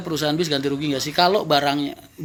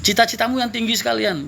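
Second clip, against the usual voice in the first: normal talking voice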